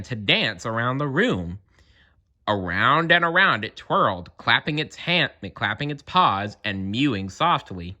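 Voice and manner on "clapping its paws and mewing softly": plain reading voice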